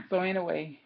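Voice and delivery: ordinary talk, nothing marked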